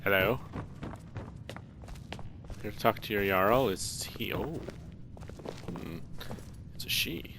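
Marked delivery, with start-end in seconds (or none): none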